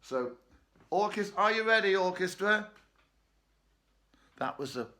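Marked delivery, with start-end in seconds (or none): 0.9-2.7 high, loud playful call, fast. Acted. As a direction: call the orchestra to attention. Calls out to toy orchestra.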